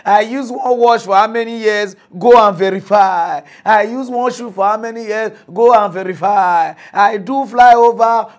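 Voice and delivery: loud chant of "Go and verify"; emphatic refrain. Retold or imitated. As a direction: hammer each "Go and verify". Emphatic Peter Obi refrain.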